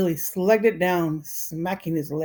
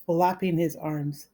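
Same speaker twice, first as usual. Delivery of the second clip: ordinary delivery, nothing marked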